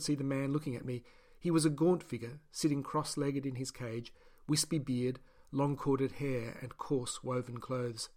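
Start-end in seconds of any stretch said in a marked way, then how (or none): none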